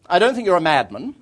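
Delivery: imitated countrywoman, firm and forthright; English countrywoman. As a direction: pronounce judgement like a formidable country lady. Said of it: "archetypal English countrywoman".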